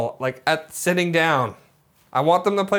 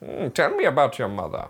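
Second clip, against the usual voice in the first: lightly voiced, lower old-time doctor; 6 semitones lower, 4 dB quieter than usual. Mock doctor questioning a patient, lightly put-on role. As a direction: play a hint of a Victorian analyst. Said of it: "This guy looks like a doctor from, like, the eighteen fifties".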